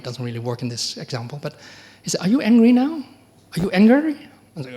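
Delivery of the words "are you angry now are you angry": higher, louder probing question; blunt question. Retold or imitated. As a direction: press the student loudly and directly. Teacher's blunt question retold.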